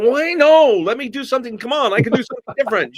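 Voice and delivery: high-pitched, laughing mock plea; pleading protest. Actor begging director to redo the voice, high pleading protest. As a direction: protest high and hurried, begging for another try. Reenacts his protest at the casting.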